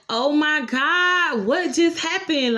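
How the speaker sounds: high, slow, drawn-out gasp of shock; mock stunned oh my God; stunned disbelief. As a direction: replay the moment the screen went blank. Retold shock when test cut off.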